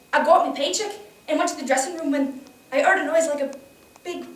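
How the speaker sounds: put-on lively character voice of a working girl; young factory worker survivor. Survivor telling of the fire, in urgent character voice.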